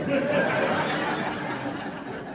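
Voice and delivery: audience laughing; crowd laughter. Real, not acted. Audience laughs after joke.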